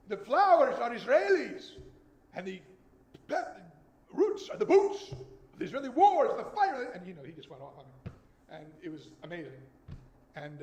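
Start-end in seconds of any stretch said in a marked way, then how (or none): 0.1-6.9 put-on loud, high, slow Israeli-accented lecture; Israeli accent imitation. Poet professor launching into his student, in a loud mock Israeli accent. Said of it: "he has a much thicker accent".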